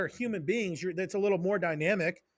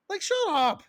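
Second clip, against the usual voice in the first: exasperated outburst; 11 semitones higher than usual. Real, not acted. Ranting against data-driven coaching.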